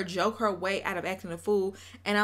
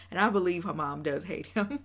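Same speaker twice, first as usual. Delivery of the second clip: ordinary delivery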